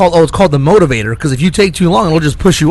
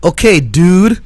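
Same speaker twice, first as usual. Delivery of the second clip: mock macho dude, a bit louder; tough guy voice; 3 semitones higher, 4 dB louder, slower than usual. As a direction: put on a fake tough-guy swagger. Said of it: "he starts talking like this".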